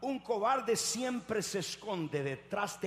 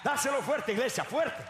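high, loud exhortation; preaching at full force; 7 semitones higher, 4 dB louder than usual. Real, not acted. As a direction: urge the church on with shouts. (arenga de predicador a gritos)

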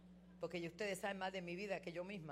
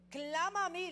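high, loud divine call; voice of God; 10 semitones higher, 8 dB louder than usual. Acted. God calling the believer to cry out, high and loud. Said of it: "le dice Dios".

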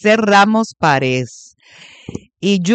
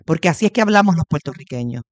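ordinary delivery

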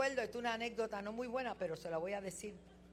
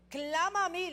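higher, louder booming call; voice of God; 10 semitones higher, 8 dB louder than usual. Retold or imitated. Pastor voicing God's call to the believer, booming. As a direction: proclaim it as God calling out. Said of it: "le dice Dios".

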